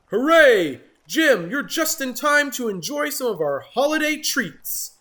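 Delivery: loud cheerful frog chorus; croaking frogs. Acted. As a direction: croak it out happily. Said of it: "croaked the frogs".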